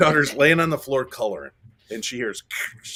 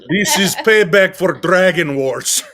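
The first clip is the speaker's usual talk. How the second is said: loud, high, slow Russian villain; mock Russian accent; 6 semitones higher, 11 dB louder, slower than usual. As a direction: declare revenge in a comic Russian accent. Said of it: "some guy in Russia who's like".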